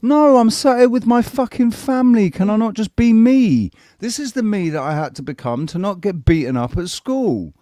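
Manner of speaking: high, loud outraged protest; hammed-up exasperation; mock outraged protest. Defending himself to his family, loud and exasperated. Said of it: "And I'm like".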